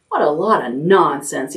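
ordinary delivery, nothing marked

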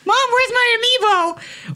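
put-on high shrill kid shouting for mom; whiny kid yelling.

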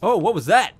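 loud, high disbelief; excited shout. Real, not acted. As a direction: yell it at the screen. Reacting to a fight.

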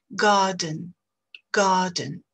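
"Garden" as long A, no R sound; silent R. Shown as a demonstration. Teacher drilling learners on silent R. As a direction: drop the R in "Garden", lengthen the A. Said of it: "remplacée par la longueur de la voyelle".

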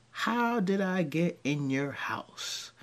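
ordinary talk

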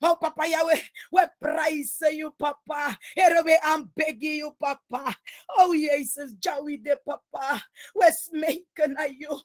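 usual speaking voice

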